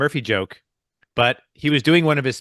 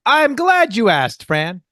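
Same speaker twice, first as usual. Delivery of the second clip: high, drawn-out "glad you asked"; hammed-up, stretched setup reply; showy joke delivery; 10 semitones higher, 3 dB louder, slower than usual. Comic answers co-host's setup question, hammed-up delight.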